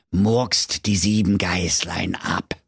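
slow whispered curse; sinister whisper. Acted. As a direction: hiss the final order. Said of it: "murmelte beschwörend".

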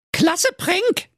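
very high, piping cheer; squeaky she-hedgehog. Acted. She-hedgehog praising the prank by message, squeakily pleased. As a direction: squeak your approval.

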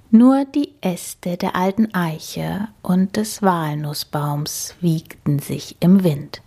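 plain reading voice

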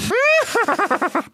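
high whinnying laugh; whinny high, falling away; zebra whinny. A narrator's reading. Zebra ends her challenge, whinnying high. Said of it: "wieherte Zola, das Zebra".

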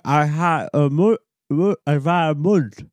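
talking with mouth held open; garbled words with open mouth. Retold or imitated. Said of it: "Was, was wär das auf Deutsch?".

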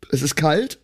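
ordinary delivery

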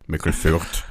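low put-on voice for a mock announcement; disguised deep voice. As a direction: drop the voice deep as a joke. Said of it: "Ich hab nur meine Stimme verstellt". (verstellte tiefe Stimme)

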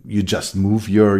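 ordinary talk

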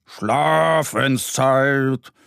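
long drawn-out bedtime call; ringing call. A narrator's reading. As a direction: sing it out slowly through the woods. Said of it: "tönt es durch den Wald". (langgezogen rufend)

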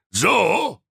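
high mock-furious So; king raging. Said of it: "tobte König Bruno Blumenkohl".

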